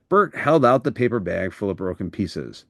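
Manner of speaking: ordinary delivery, nothing marked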